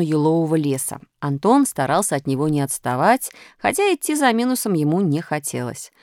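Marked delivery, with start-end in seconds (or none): none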